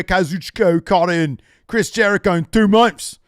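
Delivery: put-on loud, high London accent; Will Ospreay impression. Host doing Will Ospreay's promo, loud cockney. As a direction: shout it like a cocky English wrestler, bruv. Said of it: "your Will Ospreay impression".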